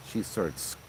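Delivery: ordinary delivery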